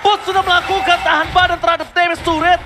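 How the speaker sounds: fast excited caster call; fighting game announcer. Acted. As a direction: call the play fast and high. Hyped play-by-play call.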